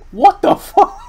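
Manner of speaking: high, loud startled swearing; startled outburst. Real, not acted. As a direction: burst out in high-pitched disbelief.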